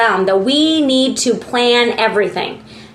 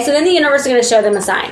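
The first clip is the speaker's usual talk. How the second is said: usual speaking voice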